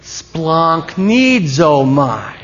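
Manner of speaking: "Splonk needs oh my" very slow, drawn-out syllables; pronunciation demo. Shown as a demonstration. Preacher having people say it because it's funny, slow demonstration. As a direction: lead "Splonk needs oh my" at a crawl. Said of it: "Try it one time".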